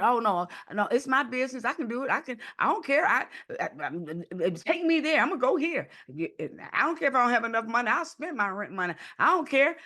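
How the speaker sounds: high, pushy mock of someone who won't be stopped; impulsive aggressive person. Impulsive person brushing off advice, high and defiant. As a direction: act out a reckless know-it-all. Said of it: "You, you decide".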